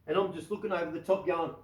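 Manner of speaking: ordinary talk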